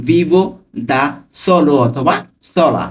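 Vivo, pause, দা, pause, then solo অথবা সোলা; word by word with pauses; broken into words. Shown as a demonstration. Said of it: "আমি কিন্তু ভেঙে ভেঙে অর্থ সহ বলছি".

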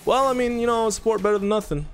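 high excited justifying. Real, not acted. Excited after surviving.

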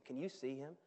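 usual speaking voice

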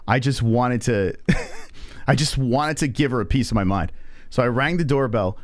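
plain reading voice

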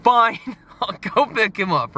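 mock-exasperated high-pitched cave-in with a laugh; exasperated giving in. Man retelling how he gave in to God, exasperated. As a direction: give in with an exaggerated huff. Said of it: "I even let out an audible sigh".

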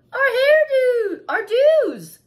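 high, loud, slow wail; hammed-up blubbering mother; boo-hooing. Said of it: "boo-hooed Ava's mother".

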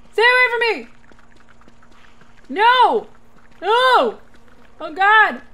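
high panicked screaming; being chased. Real, not acted. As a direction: shriek while running away. Attacked again, running home.

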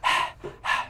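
long unvoiced breathy exhale; a breathy H, like a tired sigh. Shown as a demonstration. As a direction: push out a heavy H breath. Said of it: "we're all making the H sound".

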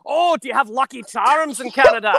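high, loud hammed-up Scottish; Scottish accent. Shown as a demonstration. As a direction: put on a comic Scottish accent, pitched high. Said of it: "here's me being Scottish".

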